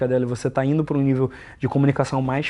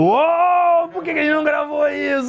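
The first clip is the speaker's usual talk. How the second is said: excited shout; 13 semitones higher than usual. Retold or imitated. Host shouting to his cohost about the missed recording. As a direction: shout it like you can't believe you missed it.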